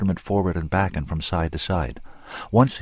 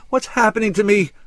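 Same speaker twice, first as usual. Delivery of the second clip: much higher, louder panicked question; frightened cry; 14 semitones higher, 5 dB louder than usual. A narrator's reading. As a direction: cry out again in growing fear. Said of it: "She cried again".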